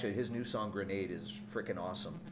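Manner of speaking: ordinary delivery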